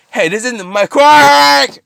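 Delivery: high, loud cry stretched into a scream; imitated shouting. Shouting back at the villain, drawn-out acted scream. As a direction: yell it, stretching the last word out. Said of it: "shouting at them".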